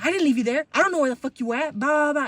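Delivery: retold pissed-off mom. Pissed mom on the phone to daughter, loud imitated scolding. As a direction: imitate a mother going off, ending dismissively. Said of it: "she was pissed".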